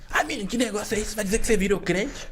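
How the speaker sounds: mother doubting her son turned evangelical, high and fast; disapproving mother. Retold or imitated.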